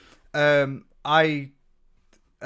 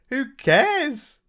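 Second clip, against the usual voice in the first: high squeaky laugh-scoff, much louder; 7 dB louder than usual. Real, not acted. Brushing off a schoolyard insult with a high laughing scoff.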